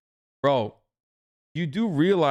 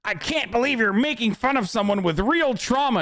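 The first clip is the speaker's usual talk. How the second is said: higher-pitched mocking whine of an offended commenter; mock outrage; 7 semitones higher than usual. Streamer mimicking internet weirdos, mock outrage. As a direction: put on an indignant online scold. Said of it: "pathetic fucking weirdos on the internet are like".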